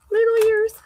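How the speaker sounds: cooing. Real, not acted. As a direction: coo it at something cute. Cooing over a drawing.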